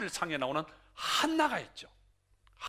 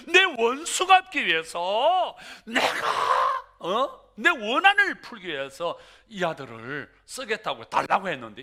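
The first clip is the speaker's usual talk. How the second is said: vengeful prayer; 8 dB louder than usual. Acted. Hannah's earlier vengeful prayer.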